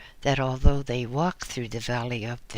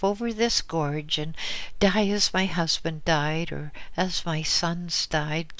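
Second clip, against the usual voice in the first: put-on higher, pleading old woman; an old woman begging for an answer; frightened old widow; 6 semitones higher than usual. Widow begs the Lama.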